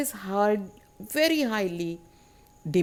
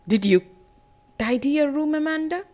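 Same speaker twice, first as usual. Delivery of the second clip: mock parental nagging, rising high on the name; 6 semitones higher, 4 dB louder, faster than usual. Parent asking Amanda about tidying her room, nagging. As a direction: check up on the child, pitched up. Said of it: "the nagging attitude of her parents".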